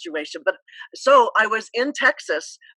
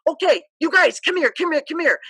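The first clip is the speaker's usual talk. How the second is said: captain calling team; 7 semitones higher, 4 dB louder than usual. Retold or imitated. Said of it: "And so she said".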